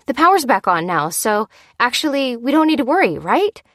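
quavering. A narrator's reading. Lucy seeking Dan's agreement not to worry, quavering. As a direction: talk yourself out of worry, uneasily. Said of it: "quavered Lucy".

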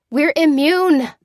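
slow, high, loud declaration; defiant cry. Acted. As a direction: throw it back at him boldly. Lucy defiantly answers the sergeant.

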